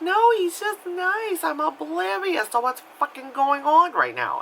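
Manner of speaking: very high, slow put-on accent; hammed-up clueless wife in a high-pitched accent; oblivious wife voice. Useless wife defends the intruder, mock oblivious. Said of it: "I gave her that accent".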